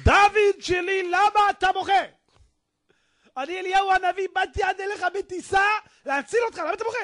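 high, sustained apparition voice; Elijah the Prophet. Acted. Prophet asks crying man why he weeps, high and ringing. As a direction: call out high, like a visitor in a dream. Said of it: "בחלומו בא אליו אליהו הנביא".

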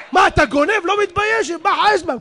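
loud, high angry rebuke; shouting down a thieving lion; angry scolding. Acted. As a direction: tell off the thief at full voice.

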